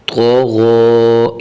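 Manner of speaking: تو غو with thick, rounded "to" and "gho"; slow, heavy emphatic consonants in تو غو; heavy, full letters. Shown as a demonstration. Teacher showing full-mouthed letters, thick and drawn-out تو غو. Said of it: "موٹا کر کے پڑھنا ہے".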